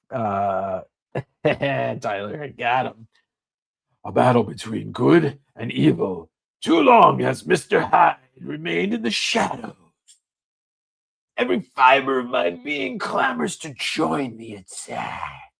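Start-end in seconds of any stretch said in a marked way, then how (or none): 4.0-15.5 lightly voiced brooding villain; a touch of menacing comic-book drama; understated Mister Hyde read; dramatic villain reading. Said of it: "dramatic panel reading".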